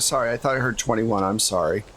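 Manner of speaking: normal talking voice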